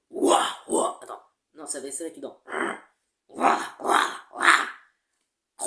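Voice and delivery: harsh unvoiced barks; medium dog bark. Shown as a demonstration. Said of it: "Cherche un chien médium".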